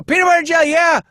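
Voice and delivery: very high, loud goofy singsong; silly kid. Pretend. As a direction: blurt it like a carefree child playing. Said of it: "they just go".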